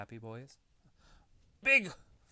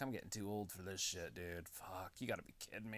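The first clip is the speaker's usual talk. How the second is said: put-on weary old wrestler; 7 semitones lower, 13 dB quieter than usual. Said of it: "Jeff Hardy's like".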